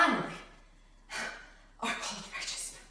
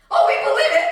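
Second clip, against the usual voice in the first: loud, high, incredulous burst; mocking outcry; 7 semitones higher, 9 dB louder than usual. Preacher on people believing in the virus, loud mockery.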